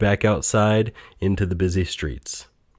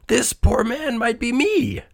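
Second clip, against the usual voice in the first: slow, high-pitched cry; Scrooge. A narrator's reading. Old miser sees himself in the dead man, high slow cry. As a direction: draw it out high in dawning dread. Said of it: "he cried".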